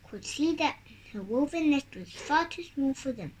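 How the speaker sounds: ordinary delivery, nothing marked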